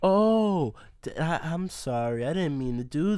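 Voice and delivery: lightly mocking sorry voice; imitating the friend's fake sorry; mock apology. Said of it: "she's like".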